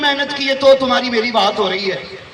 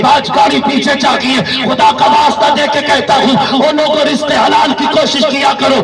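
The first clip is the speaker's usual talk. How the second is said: fiery preaching; 4 semitones higher than usual. Real, not acted. Fiery sermon climax.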